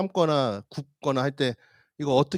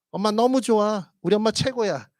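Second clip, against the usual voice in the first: put-on children's voice; 7 semitones higher than usual. Children answer mother.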